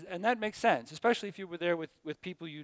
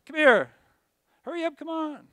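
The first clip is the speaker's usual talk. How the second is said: louder and higher, calling a student up; 11 semitones higher, 8 dB louder than usual. Real, not acted. Teacher calling student to the front, loud. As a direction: call her up to the front.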